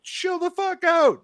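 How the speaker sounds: high, drawn-out acted yell; retold exasperated shout. Teen to freaked-out dad, retold exasperated shout. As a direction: stretch it out slowly at the top of the voice.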